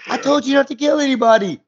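put-on podcast host hamming up young John scolding the Terminator; young John Connor impression. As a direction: pitch it up like a scolding boy. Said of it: "[name]'s impressions there".

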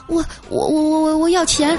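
high, stammering mock fright; hammed-up terrified stutter; pretending to be scared. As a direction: play along, badly frightened. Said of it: "还装作很害怕的样子说".

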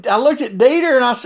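ordinary delivery

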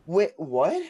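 loud, high puzzled question; confused double take; genuinely questioning. Retold or imitated. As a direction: ask it baffled, pitch jumping up. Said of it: "I literally was asking a question".

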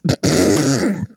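a laugh you try to hold back. Real, not acted. Nurse reading a funny comment, suppressed laugh.

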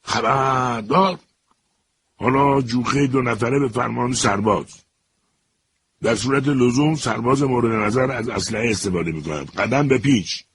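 high barked military command; a soldier's sudden parade-ground shout. A narrator's reading. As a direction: yell it like a drill sergeant gone mad. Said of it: "فریاد کشید".

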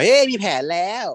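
high, sly eager announcement; scheming excitement. Retold or imitated. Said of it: "มีแผนโผล่ขึ้นมา".

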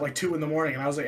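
normal talking voice

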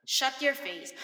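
loud, punchy comeback; mock sharp retort. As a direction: tell off the know-it-all for laughs. Mock retort to TikTok corrector. (pabirong malakas na pambabara)

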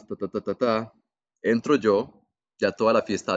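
ordinary talk

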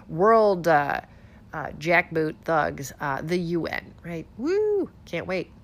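ordinary talk

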